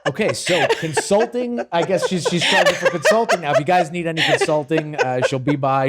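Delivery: high-pitched, laughing through the words. Real, not acted. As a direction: riff on the joke while cracking up. Host joking, cracking up at guest's quip.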